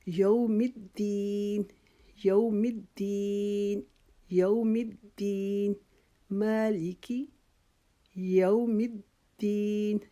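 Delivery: یوم الدین with یو stretched one alif, very slow; long یو. Shown as a demonstration. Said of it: "ایک الف کی جتنی اس کو طوالت دینی ہے".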